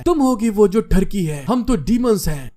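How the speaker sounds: usual speaking voice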